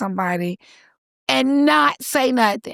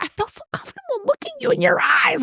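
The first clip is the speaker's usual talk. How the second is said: much higher, gushing, lightly voiced; gushing woman; 14 semitones higher than usual. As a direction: play a moved participant gushing. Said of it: "everyone's like".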